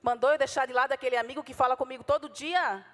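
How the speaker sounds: normal talking voice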